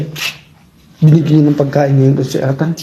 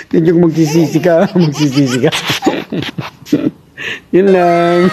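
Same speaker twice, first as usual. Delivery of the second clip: laughing through the words; 2 semitones higher than usual. Real, not acted. Quoting punchline through laughter.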